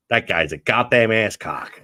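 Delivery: exaggerated American twang, loud and slow; mock American accent. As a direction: drag it out in a hammed-up American accent. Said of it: "idea what your accent might be like".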